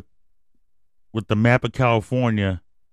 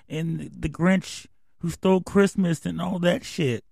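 mock outraged fan; 8 semitones higher, 4 dB quieter than usual. Mock defender of Seuss.

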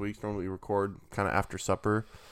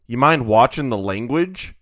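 louder, uptight complaining neighbour; prissy neighbour; 3 semitones higher, 9 dB louder than usual. Retold or imitated. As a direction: quote the neighbour, offended. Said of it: "this guy in his backyard goes".

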